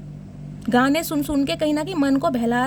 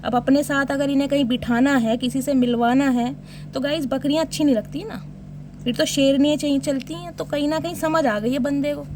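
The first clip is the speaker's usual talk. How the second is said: ordinary talk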